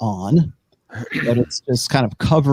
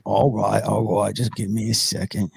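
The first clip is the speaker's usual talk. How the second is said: lightly voiced hooded giant, softer aside; a hint of big figure voice; deep-voiced figure; 3 semitones lower, 5 dB quieter than usual. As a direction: put them off in character. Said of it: "very deep voice".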